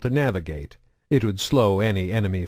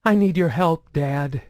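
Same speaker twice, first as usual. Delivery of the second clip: put-on higher, frightened young woman's plea; quavering voice; 7 semitones higher than usual. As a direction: beg for help, voice trembling. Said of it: "she said in a quavering voice".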